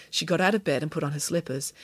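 usual speaking voice

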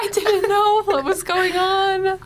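high, slow bewildered voice; confused. Acted. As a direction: sound completely lost.

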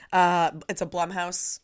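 ordinary talk, nothing marked

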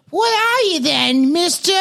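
put-on retry the kid line and land in Boston; Boston child voice. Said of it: "that's like Boston all of a sudden".

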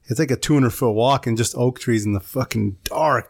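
ordinary talk

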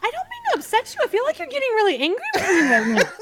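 high, squeaky mock apology. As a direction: imitate a squeaky-voiced busybody apologizing. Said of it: "little Miss Squeaky Voice".

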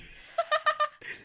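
very high-pitched giggling laugh. Real, not acted. Collector after shouting over a card, giggling high.

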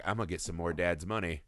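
low, soft mock scheming; understated smug aside; muttered scheme. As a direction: mumble it like a greedy son.

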